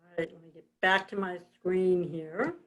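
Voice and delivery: normal talking voice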